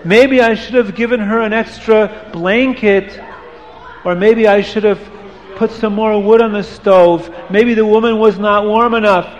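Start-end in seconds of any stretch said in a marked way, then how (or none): none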